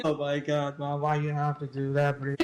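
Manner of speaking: lightly voiced exasperated teen; whining kid. Kid complaining to mom about the guilt trip, mild whiny groan. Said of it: "That's emotional blackmail right there for mom".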